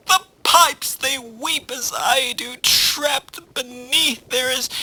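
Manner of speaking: high, wailing tragic poet; melodramatic tsunami. Acted. Tsunami pleading with a plumber, melodramatic wail. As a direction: lament in a high, theatrical voice. Tsunami laments until cut off.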